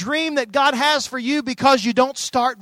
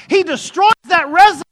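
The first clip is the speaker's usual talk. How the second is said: proclaiming loudly; 9 semitones higher, 10 dB louder than usual. Acted. Climax of sermon, proclaiming.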